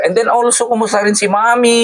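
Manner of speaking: high, sustained sing-out greeting; excited greeting. Real, not acted. Host greeting a sponsor from the vlog, excited.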